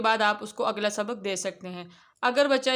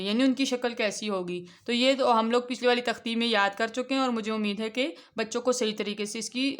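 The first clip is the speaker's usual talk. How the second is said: usual speaking voice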